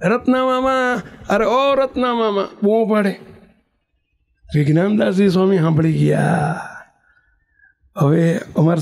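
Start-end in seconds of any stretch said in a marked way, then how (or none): 0.0-2.5 high, drawn-out mock holler; raised pitch, sing-out call without extra volume. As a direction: call for an uncle far away, stretching the vowels. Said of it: "બૂમો પાડવા માંડી".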